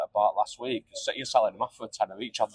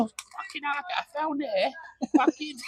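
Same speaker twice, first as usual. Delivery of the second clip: high, badly faked Cockney; Cockney accent; 13 semitones higher, 3 dB quieter, slower than usual. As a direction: put on a clumsy Cockney voice. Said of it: "He said it in a Cockney accent".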